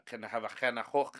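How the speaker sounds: imitated Northern English accent. Showing Americans' idea of the UK's Northerners, imitated Northern accent. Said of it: "in the U K to the Northerners".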